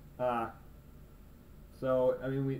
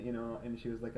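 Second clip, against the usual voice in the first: usual speaking voice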